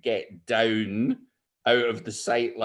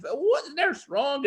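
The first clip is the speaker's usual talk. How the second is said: ordinary delivery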